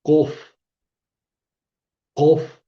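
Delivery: "kof" stressed, with the k a little back in the throat; kof demo. Shown as a demonstration. Teacher drilling the letter "kof" for the class. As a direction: clip out "kof" firmly. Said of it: "decimos la letra kof".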